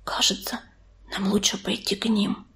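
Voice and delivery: very soft aside into an ear; hushed, tentative suggestion; whispered. A narrator's reading. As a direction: whisper it confidentially. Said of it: "склонился к уху Белочки и прошептал".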